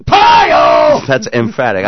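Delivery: loud emphatic shout. Shown as a demonstration. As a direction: yell it out emphatically. Host yells to show emphatic.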